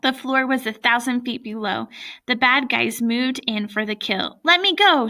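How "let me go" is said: loud, high-pitched plea; desperate raised cry to be freed; shouting. A narrator's reading. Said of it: "she shouted".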